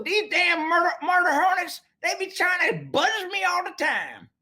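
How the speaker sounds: high country voice complaining about hornets; Southern country accent. Pretend. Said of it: "Southern character again".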